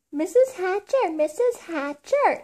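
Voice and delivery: put-on high, eager girl's call. A narrator's reading. Girl calling to her friend's mother, high-pitched calling. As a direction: call her name out high, stretching the second one. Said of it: "Sheila called".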